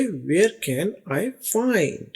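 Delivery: very slow, drawn out word by word; slow model phrase. Shown as a demonstration. Teacher modelling a store question slowly for students. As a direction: stretch every word slowly. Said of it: "teaching phrase slowly".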